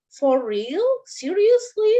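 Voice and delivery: "for real" and "Seriously" lifted as questions; questioning intonation. Shown as a demonstration. Teacher models film-style questions to students, questioning intonation. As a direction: ask "for real? Seriously?" with rising disbelief. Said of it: "intonasinya karena dia berupa kalimat tanya".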